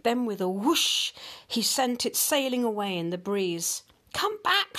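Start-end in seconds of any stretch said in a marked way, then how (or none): 4.1-4.8 shouting. A narrator's reading. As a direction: call out as it flies away. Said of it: "shouted the man".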